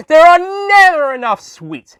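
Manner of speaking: high, slow, wailing sweet-lover; comic lament. Acted. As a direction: moan it like a sugar addict. Doctor's sweet-tooth lament.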